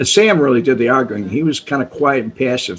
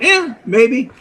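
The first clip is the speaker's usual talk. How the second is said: higher offhand shrug through a laugh; imitating casual dismissal, laughing; 10 semitones higher than usual. Sam shrugging off the danger, mock offhand with a laugh. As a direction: toss it off like it means nothing. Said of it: "that's the way he looked at it".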